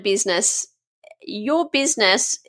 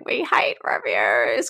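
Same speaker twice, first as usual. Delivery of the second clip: much lower and slow, a mock grumble; grumbling hospitality owner; 10 semitones lower, slower than usual. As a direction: drag it out like a fed-up café owner. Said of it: "you're probably going".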